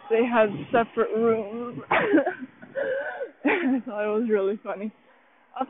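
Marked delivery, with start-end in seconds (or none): 1.8-3.4 squealing laugh, pitch jumps way up; high burst of laughter. Real, not acted. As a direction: break into a shrieking laugh. Laughs at wedding night speech.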